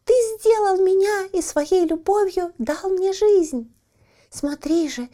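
high, thin squeaky little voice. A narrator's reading. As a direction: squeak it out like a tiny coal man. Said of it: "он пропищал".

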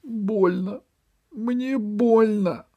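high, slow, whimpering complaint; high tearful whimper. A narrator's reading. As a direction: whine about the pain like a hurt child. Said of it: "сказал плачущим голосом".

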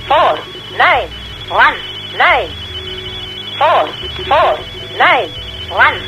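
ordinary delivery

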